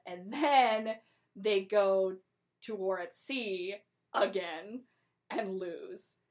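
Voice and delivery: ordinary talk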